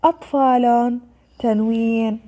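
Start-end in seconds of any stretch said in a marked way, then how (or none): 0.0-1.0 أطفال read with an added -un ending; tanween damm. Shown as a demonstration. Teacher reading out the word list, tanween damm on أطفال. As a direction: sound out أطفال as "atfaalun". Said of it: "ضم".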